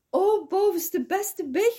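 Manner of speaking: hoog en luid uitgeroepen; uitroep. Acted. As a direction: roep het hoog en luid, vol vreugde. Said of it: "riep zij". (Roodkapje roept hoog en luid naar het biggetje)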